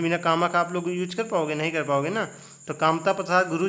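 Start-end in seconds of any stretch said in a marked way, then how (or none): none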